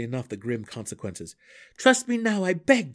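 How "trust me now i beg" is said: high, loud desperate plea; pleading. A narrator's reading. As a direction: beg him to listen. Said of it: "Drizzt pleaded".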